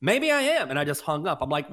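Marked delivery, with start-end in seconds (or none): 0.0-0.7 offended retort. Retold or imitated. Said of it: "I go".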